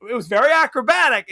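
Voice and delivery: usual speaking voice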